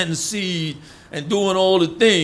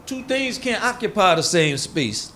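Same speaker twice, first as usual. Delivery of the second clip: ordinary delivery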